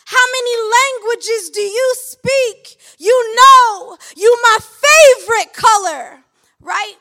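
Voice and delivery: loud, high, leering catcaller voice; hollering pickup lines at a passing woman; street catcaller. Acted. A loud, high catcaller shouting at a woman on the street.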